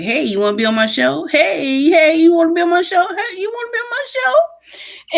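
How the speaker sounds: rising, pushy mock asking; hammed-up eager host begging; eager pitch. Mocking her own eager asking.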